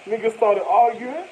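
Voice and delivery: usual speaking voice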